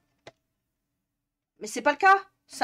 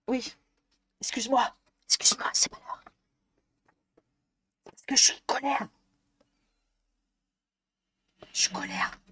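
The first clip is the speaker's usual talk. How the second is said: playful whispered apology; childlike whispered grumble. Acted. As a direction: breathe it out, mock-sulky.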